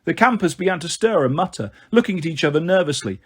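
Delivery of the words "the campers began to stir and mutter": ordinary delivery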